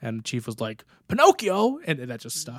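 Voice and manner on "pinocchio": high, loud rising Pinocchio?; the chief's baffled mishearing, pitched way up; incredulous question. Retold or imitated. Chief repeating the odd name back to the sailor, loud and high disbelief.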